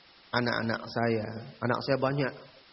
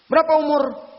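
stern question; 12 semitones higher, 9 dB louder than usual. Retold or imitated. Interrogating the teens.

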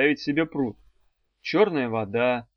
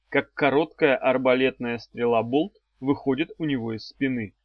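usual speaking voice

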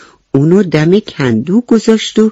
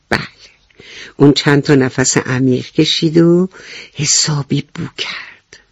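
ordinary delivery

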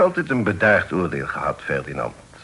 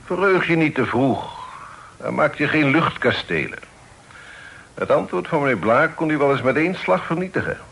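raised, firm fatherly warning; stern warning; 7 semitones higher than usual. Acted. Father silencing his overjoyed son, sternly raised voice. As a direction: cut him off and warn him sternly.

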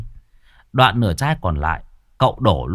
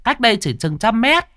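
higher, chatty gossiping woman; old tea-stall woman; 9 semitones higher than usual. Acted. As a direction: gossip like a village tea seller. (giọng bà hàng nước cao, lanh lảnh)

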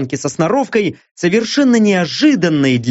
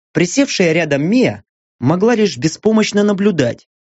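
ordinary delivery, nothing marked

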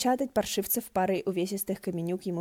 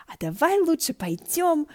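high, slow eager suggestion; excited invitation; with enthusiasm; 10 semitones higher, 5 dB louder than usual. A narrator's reading. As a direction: brighten up and pull him along. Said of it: "с воодушевлением".

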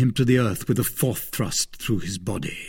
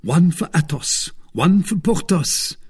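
raised exclamation on each thrust; exclaiming; 7 semitones higher than usual. A narrator's reading. Swordsman avenging friends with each thrust, exclaiming. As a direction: count off each blow for a friend. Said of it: "exclaiming at each thrust".

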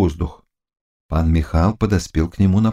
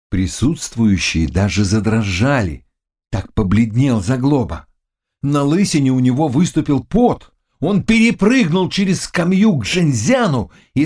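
plain reading voice

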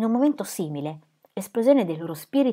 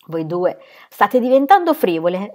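severamente; 7 dB louder than usual. A narrator's reading. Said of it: "rimarcò Lucy severamente".

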